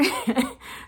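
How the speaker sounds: short happy chuckle; light laugh. Real, not acted. Laughs at self-proclaimed singer.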